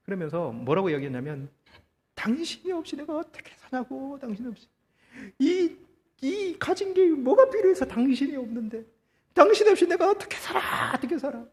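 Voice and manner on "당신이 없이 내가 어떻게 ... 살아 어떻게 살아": high, keening lament at the coffin; wailing widow. Retold or imitated. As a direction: cry out to the dead husband, pitched way up. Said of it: "펑펑 울고 있는 거예요".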